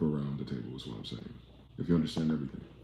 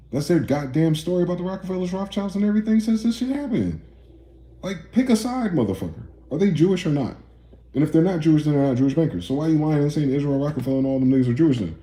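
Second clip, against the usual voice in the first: much higher and louder, furious yelling; heated yelling; 9 semitones higher, 6 dB louder than usual. Real, not acted. Streamer yelling at chat in a heated rant, furious shout. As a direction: yell it out, fed up and furious.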